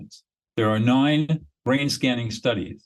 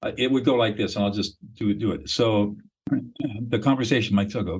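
ordinary delivery

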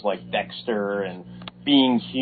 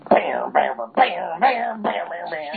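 rising bam bam riff, partly whispered; imitating opening music; 9 semitones higher, slower than usual. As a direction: mimic a glam metal intro. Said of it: "where you're like".

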